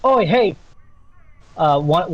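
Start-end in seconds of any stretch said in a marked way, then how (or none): 0.0-0.5 high, loud acted hail; calling out. Lumberjack spots the party, calling out. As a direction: hail someone from a distance.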